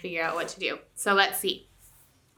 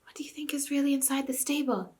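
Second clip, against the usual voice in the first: whisper; 6 semitones higher than usual. Acted. Jill whispering to Tirian, voiceless. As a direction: breathe it into his ear. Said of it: "trying to whisper".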